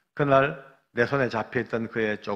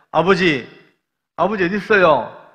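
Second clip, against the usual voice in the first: loud, higher searching call; 4 semitones higher, 9 dB louder than usual. Retold or imitated. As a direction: call out blindly for your father. Hooded son calling for father. (아버지를 애타게 부르는 큰 외침)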